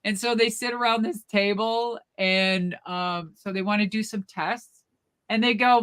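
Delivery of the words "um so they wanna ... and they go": plain reading voice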